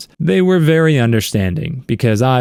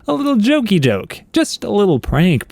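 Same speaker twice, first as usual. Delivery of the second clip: high, sing-song mocking tone; mock his excuse in a sugary voice; sarcastic mockery; 8 semitones higher than usual. Sarcastic retort to boyfriend.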